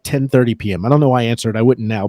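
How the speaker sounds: ordinary talk, nothing marked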